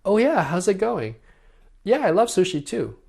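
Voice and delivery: high, eager greeting. Shown as a demonstration. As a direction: lift the whole line up high and bright.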